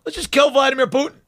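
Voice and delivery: breezy mock of the senators' line; mocking quote. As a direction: toss it off like it's nothing. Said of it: "Now they're just saying".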